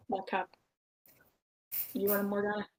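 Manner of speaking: normal talking voice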